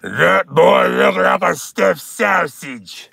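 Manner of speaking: slow, distorted PA announcement; drawn-out loudspeaker heckle. Acted. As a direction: boom it out like a jail loudspeaker. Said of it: "over the fucking loudspeaker".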